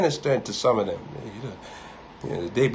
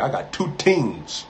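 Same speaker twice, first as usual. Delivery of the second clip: put-on slow, drawled hillbilly accent, T hit hard; mock hillbilly accent saying "teams"; joking hillbilly accent; 3 semitones higher, slower than usual. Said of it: "if a hillbilly go to Africa".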